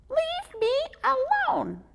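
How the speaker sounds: high, slow, loud squeaky squirrel; angry squirrel. Acted. Squirrel shooing the boy away, angry squeak. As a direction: tell him off in a squeaky squeal. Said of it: "He sounded real angry".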